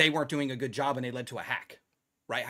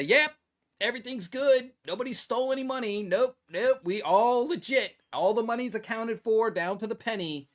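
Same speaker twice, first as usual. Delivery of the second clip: breezy CFO; 6 semitones higher than usual. Retold or imitated. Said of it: "the C F O would sign ... and say".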